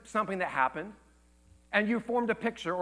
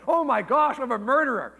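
high, loud mock alarm; hammed-up terror, pitched up; bug-eyed panic; 7 semitones higher, 8 dB louder than usual. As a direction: act out a freaked-out kid's horror. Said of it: "bug-eyed".

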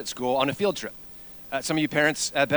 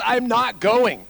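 slow, higher dug-in protest; mock stubborn refusal; 5 semitones higher, 4 dB louder, much slower than usual. Kid refuses from the bushes.

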